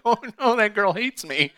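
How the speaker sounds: high, loud panicked oh no; mock panic. To himself, realising whose drink he took, high panic. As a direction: yelp it in sudden dread of her. Panics on hearing it's Meredith's.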